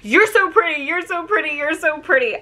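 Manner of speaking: high, repetitive fan gush, re-enacted; starstruck gushing. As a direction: blurt it like an awestruck fan. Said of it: "all that could come out of my mouth".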